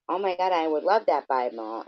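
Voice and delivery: mock-eager, lifted gushing quote; good people. Good people asking for a mailed Bible, imitated eagerly. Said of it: "then you get good people who go".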